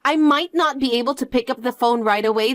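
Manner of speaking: usual speaking voice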